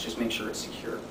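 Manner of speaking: usual speaking voice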